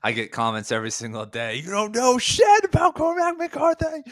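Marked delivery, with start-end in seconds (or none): 1.6-4.0 high, slow sneering mock commenter voice; reading out a rude comment, in a high, slow mocking voice. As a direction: lampoon a snide internet troll. Mocking a hostile YouTube commenter.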